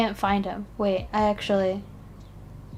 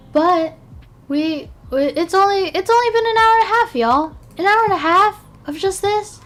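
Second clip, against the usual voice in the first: high, fast and louder, can't believe it; exasperated disbelief; 9 semitones higher, 4 dB louder than usual. Real, not acted. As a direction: rush it out in shocked disbelief. Disbelief at time spent ranking.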